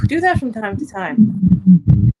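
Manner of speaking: slow, deliberate pace after catching herself talking fast. Shown as a demonstration. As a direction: ease off and let each word land. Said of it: "see how fast I'm talking? Stop".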